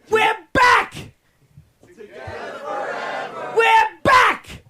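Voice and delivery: rock star shout. Shown as a demonstration. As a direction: belt it like a frontman. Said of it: "trying to think if I can add this extra line".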